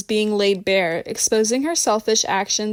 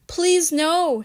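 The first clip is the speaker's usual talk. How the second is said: higher-pitched, voiced plea; mock whisper; 7 semitones higher than usual. A narrator's reading. Rebecca begs Michelle not to invite Liz, pleading whisper. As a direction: plead in a small, high voice. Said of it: "she whispered".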